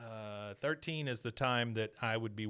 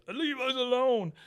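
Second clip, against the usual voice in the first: higher, slow put-on plea; mock whiny boy; 11 semitones higher than usual. Retelling Joseph telling Bobby to leave, slow put-on plea. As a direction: stretch it out, pitched up.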